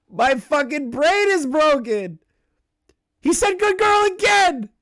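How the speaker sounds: high, loud wail of disbelief; appalled outburst. Real, not acted. Streamer telling chat he said it again, appalled shriek.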